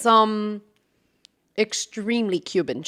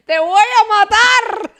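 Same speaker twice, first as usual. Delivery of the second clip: hammed-up threat; 11 semitones higher, 7 dB louder than usual. Grandma's threat quoted.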